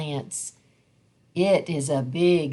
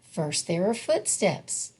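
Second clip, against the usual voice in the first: usual speaking voice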